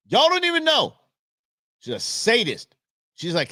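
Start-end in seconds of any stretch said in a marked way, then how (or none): 0.1-1.0 squeaky, loud disbelief; mock outrage about her; high exasperated exclaim. Real, not acted. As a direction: yelp it at the audience. Exclaiming about sadist wife.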